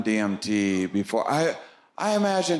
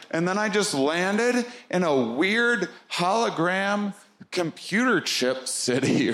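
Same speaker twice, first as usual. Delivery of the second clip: usual speaking voice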